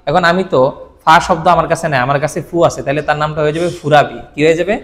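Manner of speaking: ordinary delivery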